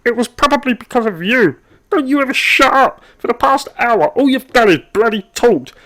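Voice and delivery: high, loud exasperated rant; angry outburst. Acted. Worker whose lunch went cold snaps at chatty colleague, angry. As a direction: finally snap and let it all out.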